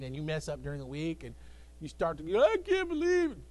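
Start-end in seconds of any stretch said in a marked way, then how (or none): 2.4-3.4 high, wailing mock lament; mock despair. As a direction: whine like someone who's failed. Voiced despairing believer.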